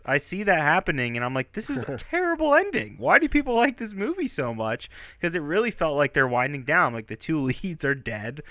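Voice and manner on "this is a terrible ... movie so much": high, mock-indignant complaint; retold annoyance. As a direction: replay the outrage at a bad ending, pitched up. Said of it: "I was so pissed".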